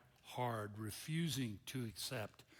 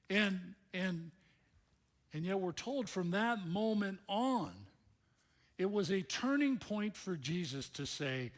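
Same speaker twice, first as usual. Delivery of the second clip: ordinary delivery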